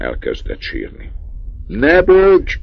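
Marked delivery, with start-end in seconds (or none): 1.7-2.6 high, loud barked command; father yelling at his sobbing son; furious shout. A narrator's reading. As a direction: shout the crying boy down. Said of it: "kiáltott rá az apja".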